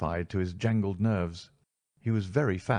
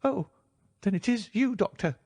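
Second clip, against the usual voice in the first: much higher, thin voice sighing with relief; relieved reedy voice; 14 semitones higher than usual. A narrator's reading. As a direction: let the fear go in a great heave. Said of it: "with a great heave of relief".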